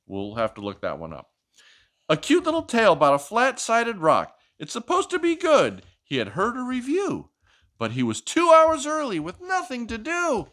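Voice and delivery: lively picture-book read-aloud; reading to a child. Acted. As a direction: read it like a bedtime story for a little one.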